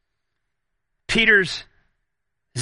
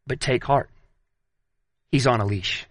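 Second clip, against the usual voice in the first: reassuring; 10 semitones lower, 3 dB quieter than usual. Real, not acted. Preacher comforting congregation.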